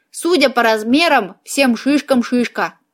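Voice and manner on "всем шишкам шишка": ordinary delivery, nothing marked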